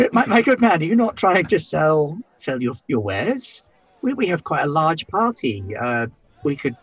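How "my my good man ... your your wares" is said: plummy British gent; hammed-up upper-class English; exaggerated British accent, lightly done; posh British accent. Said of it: "extreme British accent".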